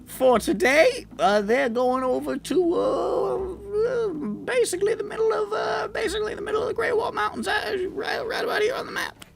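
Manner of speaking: high, squeaky goblin, eager and chummy; high excited goblin chatter. Acted. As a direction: pitch way up into a cheerful goblin squeak. Goblin explains the route.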